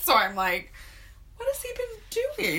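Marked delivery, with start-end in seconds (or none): 1.4-2.6 high incredulous. Retold or imitated. Retold high incredulous quote.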